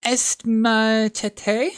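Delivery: high, very slow French phrase, O est ma tête spoken carefully; slow classroom French, O est ma tête; schoolbook French. A narrator's reading. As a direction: recite French like a nervous pupil. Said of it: "first sentence in her French lesson book".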